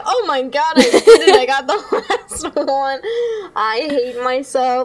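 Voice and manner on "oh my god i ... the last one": retold excitement. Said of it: "I was like".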